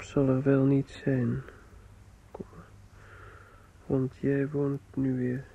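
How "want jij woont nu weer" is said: ordinary talk, nothing marked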